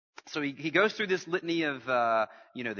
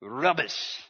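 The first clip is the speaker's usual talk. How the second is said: playful British accent, a bit louder; 6 dB louder than usual. Shown as a demonstration. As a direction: lead the room in a mock-British accent. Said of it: "Everybody in your best British accent".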